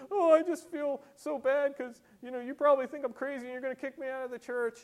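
high, whiny mock-tearful voice; mock crying woman. Preacher imitating a crying woman confessing to the pastor, mock tearful. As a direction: wail it like a weepy woman, hammed up. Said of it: "she starts crying".

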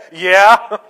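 loud laughing yes; awkward laugh. Retold or imitated. Caught with zipper down, answering the cowboy, awkward loud laugh.

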